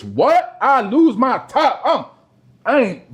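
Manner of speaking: normal talking voice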